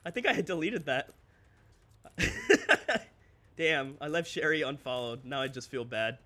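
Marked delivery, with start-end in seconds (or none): 2.2-3.0 sudden squeaky burst of laughter; high-pitched laugh. Real, not acted.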